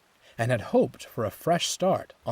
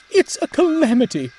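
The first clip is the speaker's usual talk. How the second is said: much higher, louder frantic cry; panicked alarm; 10 dB louder than usual. Acted. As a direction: shout the bad news in panic. Alarm about Horace in river.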